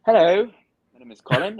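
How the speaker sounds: mock London accent, high and loud at the start. Asked by Ben for his London accent, a mock London accent. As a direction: put on a playful London accent. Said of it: "give us your London accent".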